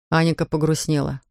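usual speaking voice

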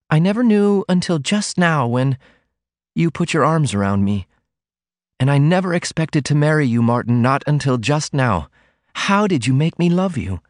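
high-pitched heroine, wondering at her love. A narrator's reading. Voices the heroine in the story.

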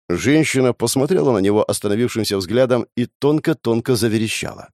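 plain reading voice

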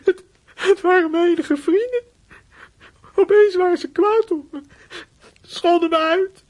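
crying through the words; half laughing, half sobbing; tearful. Acted. Boy keeps crying while explaining. (snikkend vertellen)

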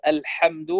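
الحمد with a clean pharyngeal ḥ; correct haa. Shown as a demonstration. Teacher giving correct recitation, الحمد with a true haa. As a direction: breathe the ḥ of الحمد from mid-throat. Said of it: "يبدلها خاء أو هاء".

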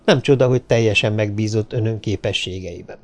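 usual speaking voice

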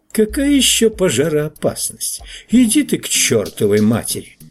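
high-pitched, irritated retort; mildly angry character brush-off; irritated dismissal. A narrator's reading. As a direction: start high and send him packing. Newspaperman curses the narrator away.

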